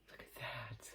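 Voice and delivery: breathless whisper, hardly voiced; awed whisper. Real, not acted. Seeing the cobalt glass pendant, she whispers in awe. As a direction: hush it out in awe.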